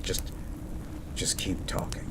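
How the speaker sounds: breathy whisper, begging them to keep talking; whispered plea. Acted. Pleading with fading partner.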